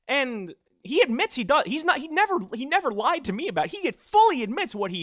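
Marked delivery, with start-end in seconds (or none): none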